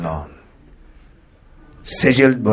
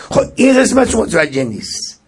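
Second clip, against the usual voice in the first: ordinary talk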